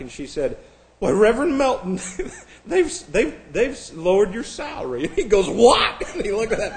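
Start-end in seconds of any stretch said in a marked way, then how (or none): 1.0-5.0 high, sweet teller voice through laughter; bank teller. Retold or imitated. Bank teller telling the pastor his pay dropped, high and sweet. 5.5-6.0 high, loud startled what; shocked. Retold or imitated. Pastor hearing his salary was cut, loud shocked cry.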